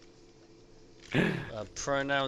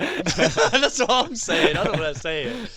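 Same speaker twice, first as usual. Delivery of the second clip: laughing, very slow and loud, high; 3 semitones higher, 9 dB louder, slower than usual. Real, not acted. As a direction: laugh the words out in disbelief. Both laughing over pronunciation.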